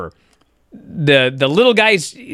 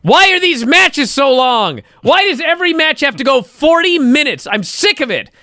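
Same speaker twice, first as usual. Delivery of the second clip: mock complaint; 7 semitones higher than usual. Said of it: "I am the first guy that complains".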